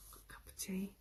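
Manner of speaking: whispered aside; a quiet unvoiced aside about the tea. Real, not acted. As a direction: breathe it with no voice. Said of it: "aside while making tea".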